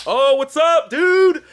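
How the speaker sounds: high, loud mock shout; excited hailing. Imitating people walking past the party hero, hollering. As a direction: holler like an adoring passer-by. Passers-by hail him, retold.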